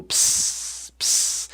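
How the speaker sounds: "ps" hissed without any vowel; "ps" as a whispery, sustained hiss; letters PS as a sound. Shown as a demonstration. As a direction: sound out "ps" as a voiceless burst into s. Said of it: "P S, que é o ps".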